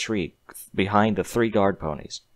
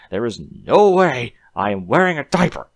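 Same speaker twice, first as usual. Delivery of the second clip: louder, defiant protest; indignant denial; 3 semitones higher, 6 dB louder than usual. A narrator's reading. Connor alone in the cell, denying the diaper loudly. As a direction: refuse it outright, to himself. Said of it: "Connor told himself".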